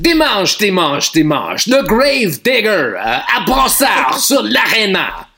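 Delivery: monster truck ad. Pretend. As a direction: shout it like a monster truck promo. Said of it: "the French monster truck announcer".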